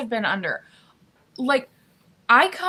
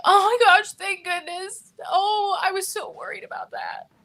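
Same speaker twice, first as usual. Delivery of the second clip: higher pitched mock sobbing relief, milking it; hammed-up fake relief; 7 semitones higher, 3 dB louder than usual. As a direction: overplay the relief to get sympathy. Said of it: "fake cry".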